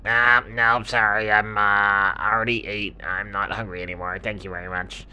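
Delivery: lightly voiced little hedgehog; hesitant hedgehog, declining.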